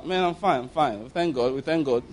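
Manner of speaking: subdued, falling, half-hearted reassurance; pretending to be fine. Worried Fred answering a friend's greeting, feigning being fine. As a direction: imitate a worried man brushing it off softly. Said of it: "But Fred is not fine".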